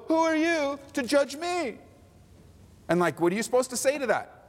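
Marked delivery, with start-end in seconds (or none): 0.1-1.8 high, slow mock indignant retort; mock indignation. Preacher voices the offended lawless person, high slow mock. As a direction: stretch it out, offended.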